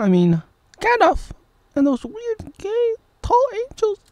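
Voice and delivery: falsetto movie character; hammed-up high fake dub; high silly dub voice. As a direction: squeak the lines like a cartoon.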